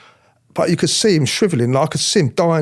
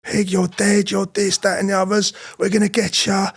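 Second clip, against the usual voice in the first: mocking inmates yelling threats, pitched higher; jeering prisoners; 6 semitones higher than usual. Prisoners on the landings taunting him, jeering threats. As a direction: taunt the new cop from the landings. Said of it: "they're all on the landings going".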